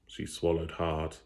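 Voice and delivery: plain reading voice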